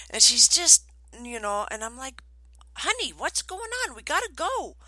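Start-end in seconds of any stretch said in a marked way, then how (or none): 2.8-4.8 put-on high-pitched mom coaxing a dawdling child; high, swooping parental plea; retold exasperated mom. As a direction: re-enact a mother urging her little one along. Impatient mother voiced in story.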